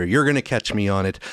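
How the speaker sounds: usual speaking voice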